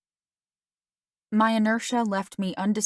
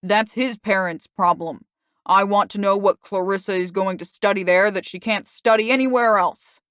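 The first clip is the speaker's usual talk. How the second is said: angry father; 7 dB louder than usual. Acted. Father angrily demands.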